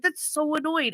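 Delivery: high but restrained complaint; mild exasperation. Retold or imitated. Parent retelling annoyance at child, mildly exasperated. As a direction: retell the annoyance without shouting. Same outburst retold more mildly.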